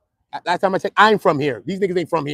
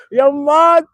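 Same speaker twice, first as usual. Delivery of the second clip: loud, high-pitched holler; comic scream; 10 semitones higher, 8 dB louder, slower than usual. Pretend. Host demonstrating how he screams for Martha, high holler. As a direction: yell it out as if calling across the street. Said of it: "I scream it".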